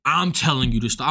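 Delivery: low mock-tough voice, lightly done; imitating the headliner. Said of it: "He's like".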